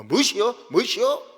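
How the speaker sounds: Jeolla dialect retort. Retold or imitated. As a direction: imitate Jeolla speaker squaring up, loud. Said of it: "전라도 욕으로 막".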